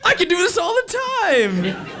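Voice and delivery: excited boast. Retold or imitated. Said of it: "And I was like".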